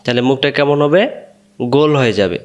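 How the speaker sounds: ordinary delivery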